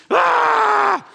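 loud, high mock rage shout; mock angry shriek. Preacher acting out fretting about politicians, loud high mock shriek.

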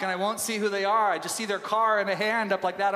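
ordinary talk

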